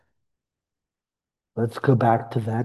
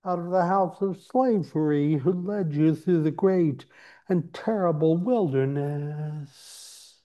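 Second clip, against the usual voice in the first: solemn scripture reading; 3 semitones higher than usual. Acted. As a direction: intone it like a sacred text.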